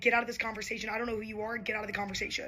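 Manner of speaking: retold curt dismissal. Telling some guy to stay out, curt dismissal. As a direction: dismiss him without raising your voice.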